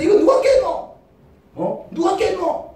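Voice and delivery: high, sharp demanding questioning; angry father. Acted. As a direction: play a dad hunting the culprit. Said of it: "아빠는 이제 ... 막 팬다".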